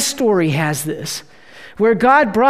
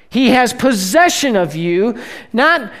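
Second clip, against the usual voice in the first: ordinary delivery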